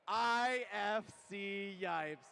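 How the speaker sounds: slow, booming name callout; hype announcer shout. Real, not acted. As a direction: stretch each letter like a ring announcer. Hype introduction of commentator.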